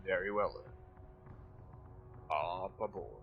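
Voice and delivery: low, soft turtle voice; quietly rumbled, unhurried turtle assent. Acted. Turtle inviting party onto its back, low and soft. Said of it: "the turtle just nods".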